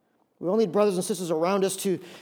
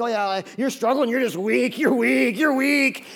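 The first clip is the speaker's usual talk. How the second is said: mock scornful jab, getting louder; dismissive scorn; 3 semitones higher, 4 dB louder, slower than usual. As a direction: sneer at the struggler. Dismissive church voice.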